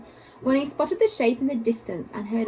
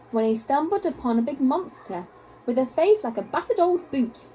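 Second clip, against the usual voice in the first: usual speaking voice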